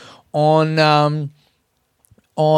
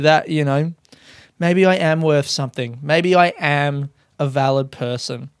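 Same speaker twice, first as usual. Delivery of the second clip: normal talking voice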